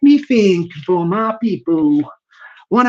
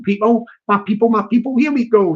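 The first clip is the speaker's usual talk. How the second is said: usual speaking voice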